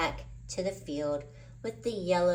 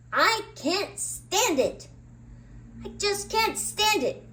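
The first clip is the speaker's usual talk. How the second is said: frustrated outburst; 13 semitones higher, 4 dB louder than usual. A narrator's reading. Charlie Brown loses it over the kite-eating tree, exasperated wail. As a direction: wail it out, at the end of his rope.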